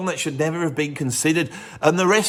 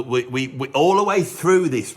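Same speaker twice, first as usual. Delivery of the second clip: usual speaking voice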